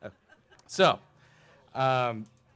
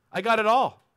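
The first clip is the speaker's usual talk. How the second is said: loud, higher proud boast; boastful ruler; 8 semitones higher, 9 dB louder than usual. Acted. Rich ruler boasting to Jesus, loud and proud. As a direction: crow that he has it all.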